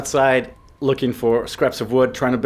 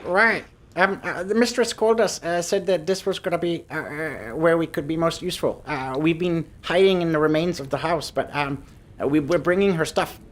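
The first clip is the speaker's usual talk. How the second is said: higher, timid little creature; high kobold voice; 6 semitones higher than usual. Acted. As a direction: answer like an eager small kobold. Kobold NPC explains their task.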